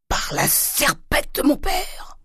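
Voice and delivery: slow, high, strained furious swearing; thundering oath. A narrator's reading. Cooper swearing at his daughter over lost gold, furious. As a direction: swear it hugely, stretched out and strained. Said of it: "Quand le tonnelier jurait ainsi, les planchers tremblaient".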